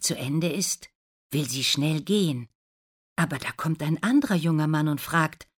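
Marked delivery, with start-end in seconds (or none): none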